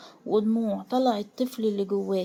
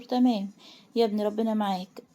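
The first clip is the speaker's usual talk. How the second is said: ordinary delivery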